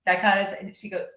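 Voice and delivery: normal talking voice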